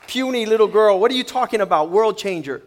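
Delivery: put-on loud, scoffing disbelief at a puny girl; mock them as they laugh her off, loud; loud incredulous scoff; scornful mock shout. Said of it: "They're like".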